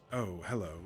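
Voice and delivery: lightly voiced, calm, methodical Corin; a mild, go-with-the-flow hello; a hint of the steady character Corin. Shown as a demonstration. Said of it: "deeper voice".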